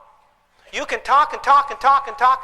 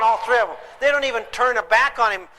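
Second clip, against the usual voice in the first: ordinary delivery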